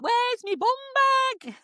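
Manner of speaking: high, wailing northern English; Danny. Retold or imitated. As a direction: imitate a ditzy northern English girl in distress. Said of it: "really ditzy anyway and really northern".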